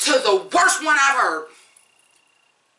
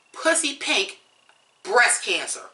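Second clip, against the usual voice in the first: ordinary delivery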